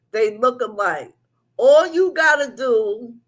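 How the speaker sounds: ordinary talk, nothing marked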